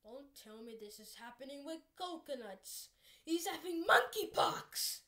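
ordinary talk

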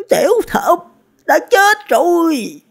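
very high-pitched cry, last words drawn out. A narrator's reading. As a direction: call out the news in a high, stretched voice. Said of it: "Sa Bà Bà lớn tiếng nói". (kêu lên giọng cao vút, kéo dài)

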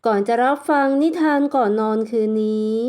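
ordinary delivery, nothing marked

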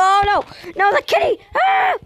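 high panicked yelling; panicked shout. Real, not acted. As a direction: yell in alarm as the cat comes. Kitty chasing player, screaming.

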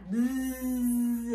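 long, steady low buzz; sustained bzzz on one note; bee buzz. Acted. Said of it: "Like a bee".

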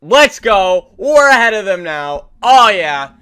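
loud hype shout; hyped. Real, not acted. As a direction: cheer it out big. Hype celebration.

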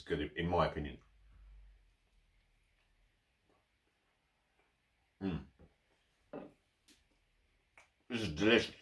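talking with mouth full; muffled between bites; chewing sandwich. Real, not acted. Seen 0: Holds sandwich at mouth, jaw chewing throughout the labelled words.

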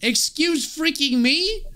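high, slow, stretched-out disbelief; outraged disbelief. Real, not acted. Player stunned by the stalker's confession, drawn-out disbelief. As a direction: hold each word in shocked outrage. Shocked at Jeff's words.

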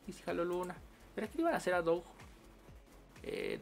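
ordinary talk, nothing marked

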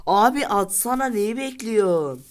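loud, high, exasperated yell at the screen; frustrated shout. Real, not acted. Gamer yelling at his team to shoot. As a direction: yell at the players to shoot already.

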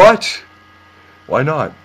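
high, loud, indignant "What?"; mock outrage. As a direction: play it shocked and offended. Prank caller feigns outrage.

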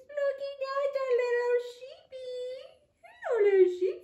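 put-on baby-talk the little sheep; cooing at sheep. Teacher greets the paper sheep, high cutesy coo.

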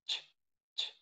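"Ch, ch" as two voiceless bursts; ch as in chicken. Shown as a demonstration. Teacher isolates the sound from chicken, bare "Ch". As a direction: isolate "Ch" with no vowel after it. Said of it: "así como si fuera el de chicken".

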